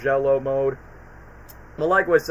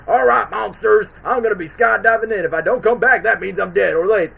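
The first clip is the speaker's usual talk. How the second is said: loud, raised-pitch military briefing; General Moninger; 6 semitones higher, 7 dB louder than usual. Retold or imitated. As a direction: address the troops like a cartoon general. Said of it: "General Moninger, who's one of the best side characters".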